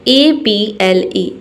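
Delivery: ए बी एल ई spelled very slowly, letter by letter; slow spelling. Shown as a demonstration. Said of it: "इसे हम पढ़ेंगे एबल". (ए बी एल ई को धीरे-धीरे अक्षर बोलिए)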